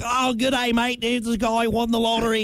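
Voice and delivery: light Australian accent put on for a news report. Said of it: "I went from Australian to redneck".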